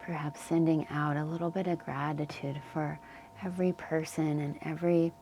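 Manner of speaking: calm Yoga Nidra guidance with long pauses; unhurried, leave space between phrases to settle them in; guided meditation. Real, not acted. Yoga teacher settling listeners into the earth's support, calm guided meditation.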